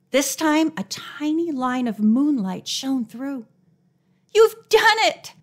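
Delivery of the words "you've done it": high, loud burst of relief; relieved exclamation. A narrator's reading. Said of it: "Nancy exclaimed with relief".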